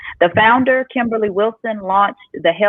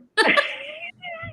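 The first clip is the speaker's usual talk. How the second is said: delighted laugh; 7 dB quieter than usual. Real, not acted. Laughs at the shared memory.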